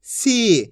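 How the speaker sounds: "सी" with the ee held long; long drawn-out ee. Shown as a demonstration. Teacher answering what sa becomes, "सी" drawn out. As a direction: stretch the vowel of "सी". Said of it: "खींच के पढ़ा जाता है".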